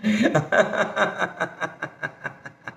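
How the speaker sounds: staccato laugh tapering off; laughter exercise. Shown as a demonstration. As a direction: laugh on one breath until it fades. (विरत जाणारं हास्य)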